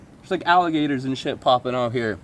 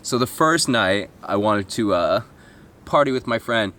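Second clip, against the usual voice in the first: ordinary talk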